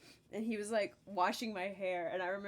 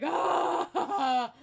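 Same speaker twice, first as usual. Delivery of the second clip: loud, high mock wail; 6 semitones higher, 9 dB louder than usual. Sick kid answering dad's reassurance, wailing. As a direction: cry out in hammed-up protest. Retelling a child wailing in protest.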